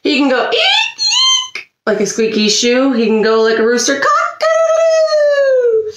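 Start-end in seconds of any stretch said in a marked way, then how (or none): 0.0-1.7 tiny high squeak like a squeaky shoe; rubbery high squeaky toy. Pretend. 4.0-5.9 high stretched-out rooster call; rooster crow. Pretend. Imitating a rooster.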